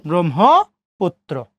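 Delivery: ব্রহ্মপুত্র slowly as "brom-ho-put-tro"; ব্রহ্মপুত্র in slow, separated syllables; pronunciation demo. Shown as a demonstration. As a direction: double the t in ব্রহ্মপুত্র. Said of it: "তর উচ্চারণ কয়বার? দুইবার".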